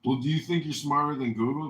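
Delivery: normal talking voice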